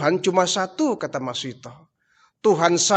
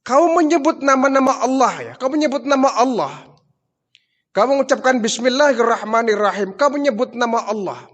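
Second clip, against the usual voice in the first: angry accusation; 8 semitones higher, 4 dB louder than usual. Acted. Pharaoh accuses servant of faith.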